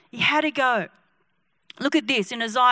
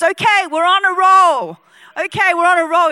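loud, higher-pitched triumphant call; excited preacher; 4 semitones higher, 7 dB louder than usual. Acted. Young preacher after his first healing, excited acting. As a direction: whoop it up for the crowd, acted.

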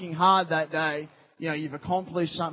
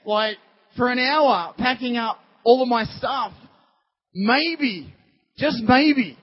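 higher, louder mock grievance; imagined indignant protest; 5 semitones higher, 5 dB louder than usual. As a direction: argue back like a put-upon fisherman. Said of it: "you can imagine Peter thinking".

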